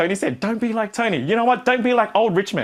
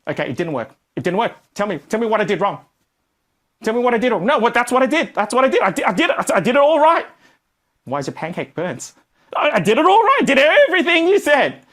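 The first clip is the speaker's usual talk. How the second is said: defensive; 5 semitones higher, 3 dB louder than usual. Retold or imitated. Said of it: "You turn up to the feedback like this".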